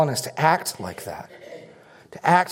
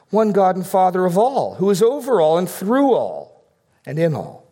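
high rising sermon chant on each one; preacher lifting each one in turn; preaching build; 5 semitones higher than usual. Acted. Pastor to congregation, lifted preaching. Reads the creed of oneness.

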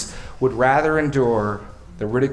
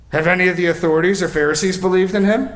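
high, loud, fast scornful question; indignant challenge; 7 semitones higher, 5 dB louder, faster than usual. Acted. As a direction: demand it with arrogant contempt.

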